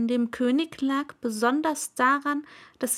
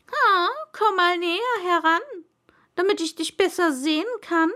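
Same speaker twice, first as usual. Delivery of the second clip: high, loud royal voice, quicker at the end; pompous king voice, much higher; proud king; 8 semitones higher, 5 dB louder, faster than usual. Acted. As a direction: beckon proudly like a pompous monarch. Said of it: "ganz stolz darauf".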